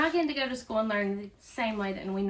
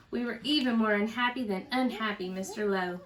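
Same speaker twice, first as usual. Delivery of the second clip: plain reading voice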